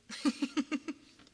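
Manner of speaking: high, loud giggle; real bubbly high laugh. Real, not acted. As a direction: burst into a quick giggle. Amused while chatting.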